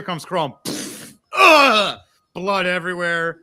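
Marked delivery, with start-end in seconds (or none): 1.4-2.0 loud, high impact grunt; pained grunt. Acted. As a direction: sell the hit on the table. Crom crashes onto bar table.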